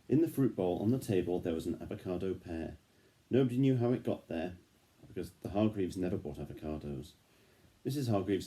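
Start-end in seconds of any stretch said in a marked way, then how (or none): none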